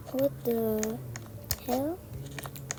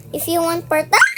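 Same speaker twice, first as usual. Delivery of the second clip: raised voice bursting into a yell; shouting; 3 semitones higher, 7 dB louder than usual. Real, not acted. Player pitching part two to viewers, shouting. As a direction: shout it, breaking off into a scream. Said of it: "Ba't pa ako sumisigaw?".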